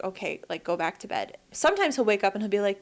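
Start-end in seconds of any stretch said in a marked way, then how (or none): 0.0-1.4 quiet dismissal. Retold or imitated. Retold weary reply at night.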